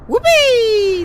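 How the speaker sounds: a high, loud whoop of joy; excited whoop. Acted. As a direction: yell it like you're on a thrilling ride. Joyful yell speeding down tunnel.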